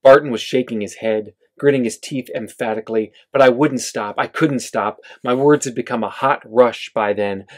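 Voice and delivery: ordinary delivery, nothing marked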